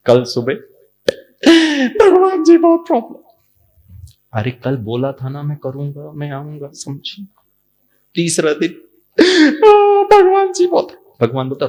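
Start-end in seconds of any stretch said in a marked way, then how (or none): 1.9-3.2 whining devotee. Retold or imitated. Devotee wailing problems to God, high mock sob. 4.3-7.3 softer, fading reminder; patient God. Retold or imitated. God reminding the devotee of his promise, fading soft. 9.6-11.2 high, slow mock wail; whining devotee. Devotee wailing problems to God, high mock sob.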